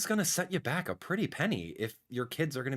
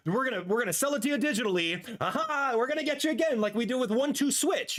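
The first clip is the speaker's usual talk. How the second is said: higher, quick, gloating mock voice; gleeful corporate villain; 9 semitones higher than usual. Streamer mocking Nintendo selling a tech demo, gloating villain voice. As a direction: ham up the greedy company cashing in.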